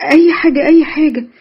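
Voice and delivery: أي حاجة said high and loud, repeated; emphatic insisting. A narrator's reading.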